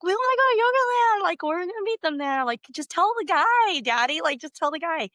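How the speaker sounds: mock kids nagging daddy to just go tell the guy; kids pleading. Kids in the back seat begging daddy to tell the guy, high and whiny. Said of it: "the kids are like".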